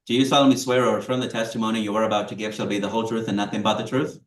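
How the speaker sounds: rapid rote oath, loud; fast flat court clerk. Real, not acted. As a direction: rattle off the oath at speed.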